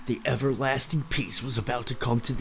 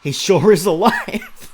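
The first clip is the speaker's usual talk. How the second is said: mock hero voice; 6 semitones higher, 11 dB louder, slower than usual. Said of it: "dude speaks like that".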